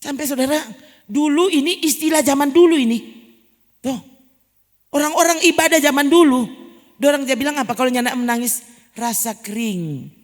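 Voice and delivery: loud, high preacher's voice pressing the point; full-force sermon delivery; fervent preaching. Acted. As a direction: drive it home to the congregation.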